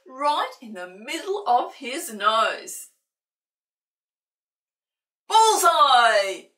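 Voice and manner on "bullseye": loud, high triumphant shout. Acted. Old Sid shouts as the hose hits the cat. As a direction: crow it as the water hits.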